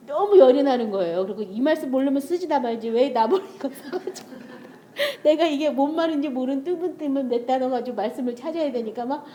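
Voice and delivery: ordinary delivery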